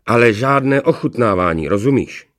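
loud, firm warning; emphatic warning. A narrator's reading. Rumburak forbidding Blekota to taste, emphatic. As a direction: warn him off forcefully. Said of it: "Varoval ho důrazně".

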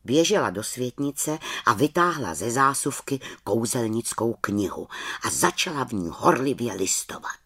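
usual speaking voice

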